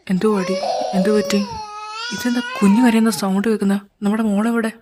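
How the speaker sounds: worried and rising, near tears; alarmed. Acted. Husband anxiously asking why the baby is crying. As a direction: ask anxiously, voice catching.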